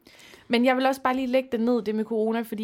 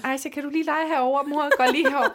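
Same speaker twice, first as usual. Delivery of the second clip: higher, louder pretend-casual chatter; put-on breezy parent; 6 semitones higher, 4 dB louder, faster than usual. Mother hiding from French dad at party, put-on cheery voice. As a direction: act busy to dodge someone. Acting out diverting play while hiding.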